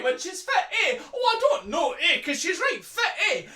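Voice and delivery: high, loud mimicry of Fife lads; broad Fife back-and-forth, each line swinging up; Fife accent. Retelling three lads' chat on a plane, broad Fife accent. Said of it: "That is Fife".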